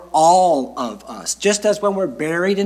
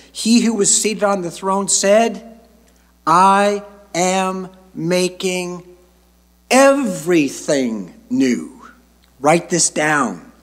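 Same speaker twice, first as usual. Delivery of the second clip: slow, proclaiming Bible reading with long pauses; solemn scripture reading; 3 semitones higher, slower than usual. Acted. Preacher reading Revelation to the congregation, slow proclamation. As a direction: proclaim the verses in measured, ringing phrases. Resumes reading verse five.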